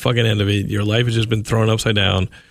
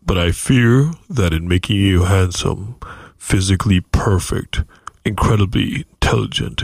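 God voice; 3 semitones lower than usual. Pretend. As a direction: pass judgment like a stern God. God speaking to Zach.

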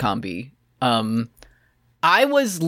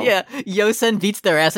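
ordinary talk

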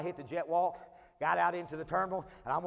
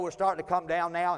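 ordinary talk

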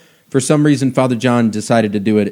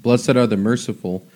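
scripture recitation; 3 semitones lower, 7 dB quieter than usual. Acted. Quoting the Beatitudes softly.

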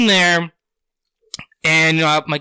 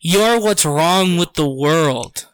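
slow, deliberate retold condemnation; imitating a grave, measured accuser; dead-serious accusation; 2 semitones lower, slower than usual. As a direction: spell out the blame slowly, staring them down. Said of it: "looked at me dead in the fucking eyes".

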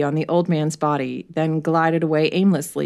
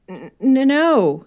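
higher, louder stuttered no; stammering; 8 semitones higher, 5 dB louder, slower than usual. Acted. Merton caught out by the inspector, stammering. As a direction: trip over the no. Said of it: "he stammered".

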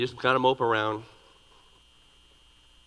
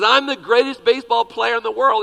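put-on loud, higher, bragging little boy; boastful little boy; 7 semitones higher, 9 dB louder than usual. Little boy with a bat brags to himself, loud. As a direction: shout it out like a cocky kid. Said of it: "He says".

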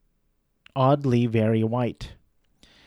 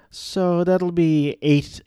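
clerk quoting the price; 6 semitones higher, much slower than usual. Retold or imitated. Clerk quoting the total charge.

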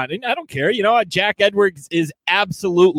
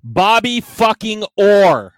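outraged; 5 semitones higher, 4 dB louder, slower than usual. Real, not acted. Outraged reveal of agent.

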